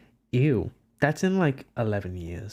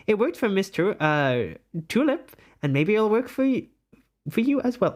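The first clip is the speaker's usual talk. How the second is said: high, lilting theatrical advisor; put-on character voice; 7 semitones higher than usual. Streamer ends the quiz result in character voice. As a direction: finish the speech in a high put-on voice. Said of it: "let me do this in a voice".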